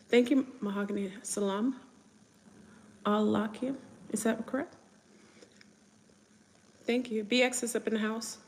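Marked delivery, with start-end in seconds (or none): 1.2-3.8 "Salam" and "alaikum" each lifted like a question; tentative attempt. Real, not acted. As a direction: say "Salam… alaikum" haltingly, rising to check it. Checking whether greeting is correct.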